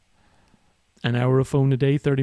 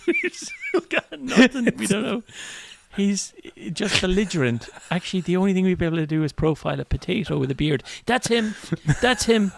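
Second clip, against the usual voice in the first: put-on high, trembly little old lady voice; frail old lady; 8 semitones higher than usual. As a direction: play a fragile granny. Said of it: "make Kelly sound like a, you know, she's just a frail".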